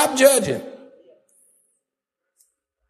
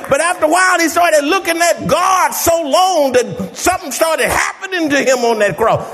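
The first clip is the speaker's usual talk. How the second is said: preaching at full force; 10 semitones higher than usual. Acted. Preacher shouting the thief's story to the congregation, full-force preaching. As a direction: lift the whole church with it.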